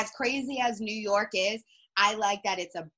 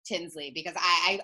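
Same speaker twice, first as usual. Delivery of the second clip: usual speaking voice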